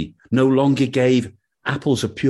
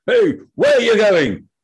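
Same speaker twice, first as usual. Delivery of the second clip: high, loud guard's challenge; shouting sentry; 13 semitones higher, 5 dB louder than usual. Acted. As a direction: bark the halt like a sentry. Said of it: "a sentry, who said".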